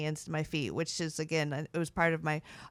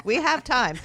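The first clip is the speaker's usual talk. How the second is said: playful; 6 semitones higher, 6 dB louder than usual. Real, not acted. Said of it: "joking reassurance after correcting herself".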